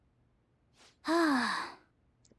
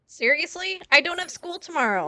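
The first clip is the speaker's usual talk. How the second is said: higher, incredulous seriously; disbelieving outburst, pitched up; surprised; 7 semitones higher than usual. Real, not acted. Reacting to game dialogue.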